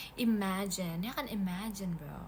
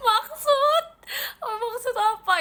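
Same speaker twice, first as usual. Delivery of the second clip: much higher and louder, indignant; shocked squeal; 12 semitones higher, 9 dB louder, slower than usual. Real, not acted. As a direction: squeal it out in disbelief. Reacting to chat comment.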